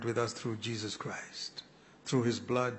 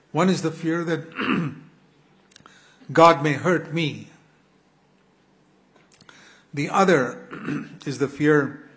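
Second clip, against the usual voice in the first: preaching at full force; 3 semitones higher, 8 dB louder than usual. Acted. Preacher teaching the congregation the two fears, at full force. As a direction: drive each fear home to the hall.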